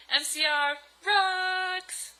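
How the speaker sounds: high, drawn-out fangirl whoop; mock-excited shout-out; hammed-up cheer. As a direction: cheer it slowly, stretching the last word. Cheering sign-off of author's note.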